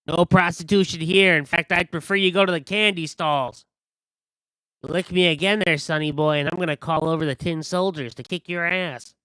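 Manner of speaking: high, loud scolding merchant voice; huffy high shopkeeper; indignant shopkeeper. Acted. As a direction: tell off a rude customer, threatening. Shopkeeper scolds licking customer.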